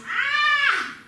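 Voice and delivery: high, loud "ah" scream; pained scream. Acted. Son trips on a forest walk, pained scream. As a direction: scream out a sharp pain. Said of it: "he screams".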